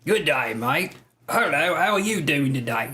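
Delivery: mock drunken Aussie greeting; hammed-up Australian hello; Australian accent. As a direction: put on a broad, swooping Australian accent. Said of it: "I'm gonna start speaking Australian".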